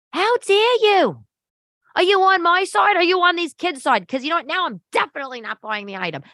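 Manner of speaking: high-pitched indignant tirade; mock outrage. Mom rebukes sales girl over the doll, high mock outrage.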